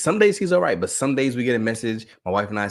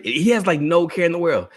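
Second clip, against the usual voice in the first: usual speaking voice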